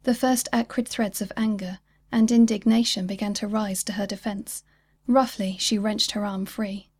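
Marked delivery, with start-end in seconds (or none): none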